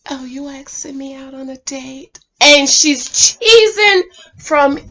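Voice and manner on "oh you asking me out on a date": near-whispered imitation of a delighted woman; coy, hushed imitation. Woman reacting to being asked on a date, coy hushed imitation. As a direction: play her softly, almost under the breath. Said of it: "She said".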